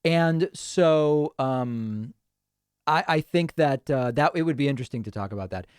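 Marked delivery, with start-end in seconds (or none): none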